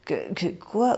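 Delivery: stammering, caught off guard; a hint of panic in the stutter; nervous stammer. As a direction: trip over the first word in fright. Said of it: "sourire crispé".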